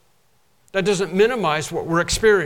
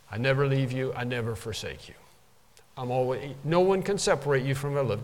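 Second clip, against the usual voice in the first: softer, lower tender promise; gentle voice of God; 5 semitones lower, 5 dB quieter than usual. Acted. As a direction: speak down softly like God. Voices God speaking from heaven.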